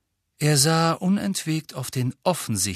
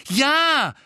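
high, loud and fast angry protest; snapping back in anger; 6 dB louder than usual. Acted. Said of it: "sagte Harry zornig".

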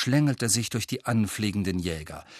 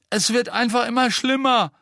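high, loud complaint with a thick voice; high, labored wail; thick, dragging voice; 13 semitones higher, 5 dB louder than usual. A narrator's reading. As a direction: complain through a nosebleed. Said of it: "sagte Katie mit schleppender Stimme".

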